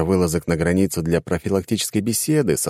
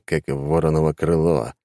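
ordinary delivery

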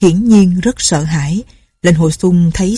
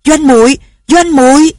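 high, loud call for a loved one; calling out loudly; 8 semitones higher, 5 dB louder than usual. A narrator's reading. Lệnh Hồ Sung searching for Doanh Doanh in the cave, calling loudly. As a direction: cry her name across a noisy crowd. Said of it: "lớn tiếng gọi".

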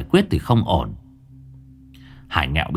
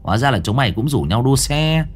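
scoffing youth; 10 semitones higher than usual. A narrator's reading. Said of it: "Thằng Long bĩu môi".

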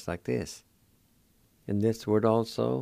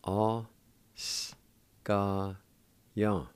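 slow, syllable by syllable. Shown as a demonstration. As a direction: split the word into syllables, one at a time. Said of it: "It's pronounced like this".

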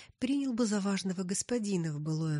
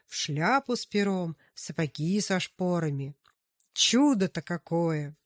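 excited raving; 2 semitones higher, 6 dB louder than usual. Acted. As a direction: gush about royal life. Miller raves about being king.

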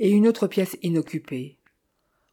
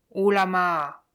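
shrill parrot voice; slower than usual. A narrator's reading. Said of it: "Répondit-il d'une voix stridente".

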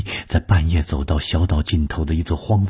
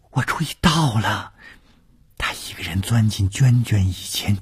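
put-on higher-pitched urgent girl, partly whispered; urgent, hushed; 6 semitones higher than usual. A narrator's reading. Maid reporting to her mistress what she overheard, urgent and hushed. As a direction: blurt it out anxiously, breath breaking into whisper. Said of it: "雪花儿急促地说".

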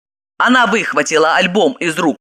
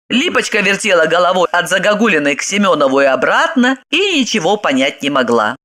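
ordinary delivery

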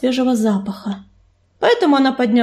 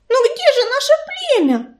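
wailing; 12 semitones higher than usual. A narrator's reading. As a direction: wail it high, without shouting. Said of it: "взвыла Белочка". (высокий жалобный вой)

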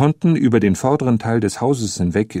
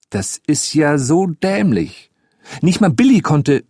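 high, indignant outburst, louder at the end; angry boy; 6 semitones higher, 3 dB louder than usual. A narrator's reading. Boy glaring at the man who doubts him, angry. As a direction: start drawn out and exasperated, then flare up. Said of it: "funkelte ihn wütend an".

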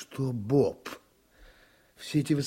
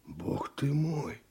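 stunned whisper; 3 semitones higher, 6 dB quieter, much slower than usual. Acted. Indignant confrontation with the judge.

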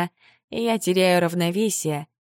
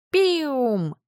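high, falling little squeak; creature squeak; 8 semitones higher than usual. Acted. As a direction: chirp like a tiny animal.

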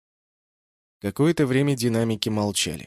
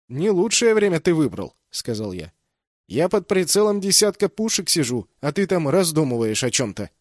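exasperated; 5 semitones higher, 5 dB louder than usual. A narrator's reading. Возмущение под прицелом.

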